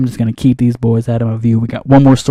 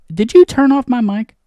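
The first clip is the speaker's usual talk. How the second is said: indignant; 11 semitones higher than usual. Acted. Indignant outburst at booth crew.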